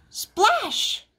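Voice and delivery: high, exclaimed splash; splash effect. A narrator's reading. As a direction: pop it up high like hitting water. Sound effect in story.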